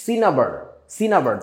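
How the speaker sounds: সিনাবার with a single n, si-na-bar, English style; English pronunciation. Shown as a demonstration. Teacher to students, showing the English way. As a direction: say সিনাবার the English way, one n not two. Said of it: "ইংরেজি উচ্চারণটা এরকম".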